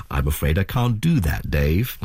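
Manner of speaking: lightly voiced calm computer, dropping low on the last word; a hint of an AI computer voice, pitch sinking at the end; HAL. Host quoting a film AI to the guest, light HAL impression. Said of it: "my best hal voice".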